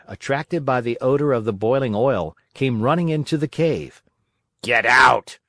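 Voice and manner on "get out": loud, menacing fisherman's bark; threatening shout. A narrator's reading. Fisherman to a dog running into his cave, threatening. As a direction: order the intruder out with sudden force. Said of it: "cried the fisherman threateningly".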